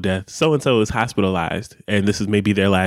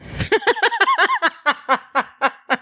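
high burst of laughter; big laugh. Real, not acted. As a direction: crack up at your own punchline. Punchline of own story.

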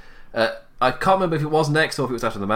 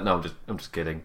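quick, lighter jokey take; changed jokey tone; 4 semitones lower, 5 dB quieter, faster than usual. Shown as a demonstration. Showing how Cedric should've said it, quick jokey tone. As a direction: switch tone to show it's a joke. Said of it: "I just changed my tone there".

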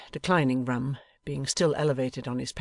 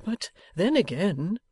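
high, slow, gentle objection; meek, coaxing old man; 6 semitones higher, 3 dB louder, slower than usual. A narrator's reading. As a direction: venture it delicately. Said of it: "Mister Boffin gently represented".

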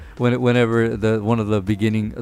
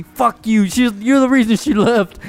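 higher, louder hammed-up argument; mock angry yell; 11 semitones higher, 5 dB louder than usual. [name] yelling at the monkey, mock angry.

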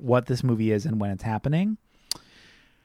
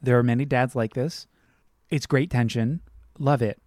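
normal talking voice

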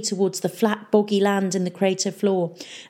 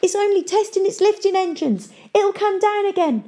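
high, desperate plea; pleading; 3 dB louder than usual. A narrator's reading. Mayor stuck in bog to his pirates, high pleading. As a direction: beg for rescue in a high voice. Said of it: "the mayor pleaded".

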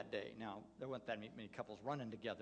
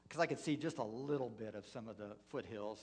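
ordinary delivery

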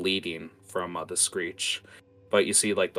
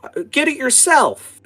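put-on a rude little girl's retort, much higher and louder; bratty child; 13 dB louder than usual. Little girl to the woman, refusing the chore, high and bratty. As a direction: sass the grown-up like a spoiled kid. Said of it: "she looks at her and goes".

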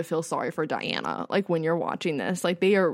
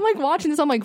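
ordinary delivery

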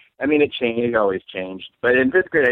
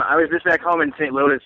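usual speaking voice

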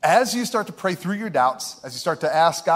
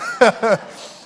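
laughing; 5 semitones higher, 5 dB louder than usual. Real, not acted.

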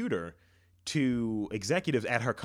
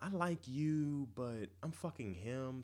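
slow, soft cheating woman; 9 dB quieter, slower than usual. Pretend.

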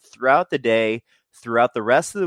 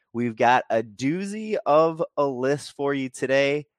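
usual speaking voice

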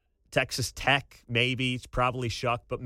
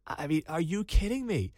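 ordinary talk, nothing marked

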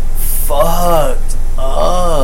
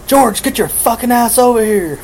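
angry yell; 9 semitones higher than usual. Retold or imitated. Dad yelling from the living room.

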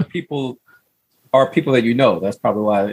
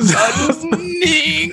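high squeal of laughter; laughing. Real, not acted. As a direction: crack up mid-word. Laughing hard.

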